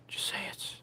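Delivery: breathy, pleading whisper; sneaky little coaxing whisper. Real, not acted. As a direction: egg him on under your breath. Coaxing someone to say it.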